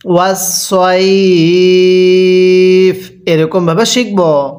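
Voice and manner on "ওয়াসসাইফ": very slow, drawn-out melodic recitation; slow chanted tajweed reading; Quran recitation. Shown as a demonstration. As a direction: recite each syllable long and sung. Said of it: "পড়ুন".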